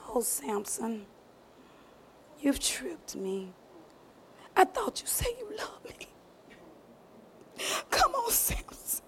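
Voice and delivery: breathy whisper, hurt and pleading. Acted. Delilah whispering her hurt to Samson. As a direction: whisper it like she's wounded.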